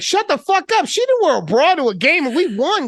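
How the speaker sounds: high-pitched, fired-up defence; mock indignant outburst. Defending her fandom to doubters, mock outrage.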